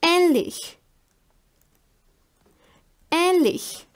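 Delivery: "ähnlich" with a long open eh at the start; umlaut example. Shown as a demonstration. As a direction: say "ähnlich" as a careful German model word. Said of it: "For example".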